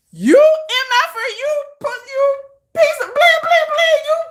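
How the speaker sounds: high, sputtering mock tirade; mock cussing-out. Host imagining cussing out the fans, mock furious. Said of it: "I be wanting to be like".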